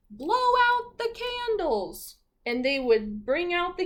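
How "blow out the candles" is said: high, slow, squeaky voice; misheard order. Acted. Crew hearing the captain's order wrong, high and slow. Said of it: "his crew would hear".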